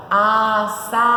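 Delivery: Açaí in three long, loud syllables, a, ça, í; split into syllables. Shown as a demonstration. Teacher showing how to separate it, Açaí syllable by syllable. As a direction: pull Açaí apart, holding every syllable. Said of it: "como que separa?".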